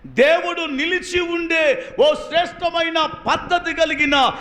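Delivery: high, ringing preacher voice at full force. Acted. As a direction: preach it hard to the congregation. (ఉత్సాహంగా బోధించడం)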